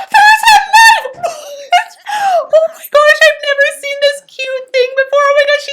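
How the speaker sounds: gasp, then very high, loud squeal; thrilled shriek. Real, not acted. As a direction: shriek in real amazement. Streamer reacts to knife in cake cutting.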